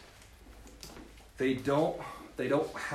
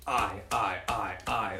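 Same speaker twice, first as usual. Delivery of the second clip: imitating an I personality; 3 dB louder, slower than usual. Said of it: "You'll hear them use the word I a lot".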